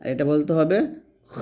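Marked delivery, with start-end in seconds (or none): 1.3-1.4 খ as a heavy back-of-throat rasp, vowel held long; thick খ. Shown as a demonstration. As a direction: make খ thick from the end of the throat. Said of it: "মোটা করে". (গলার শেষ থেকে মোটা করে লম্বা খ)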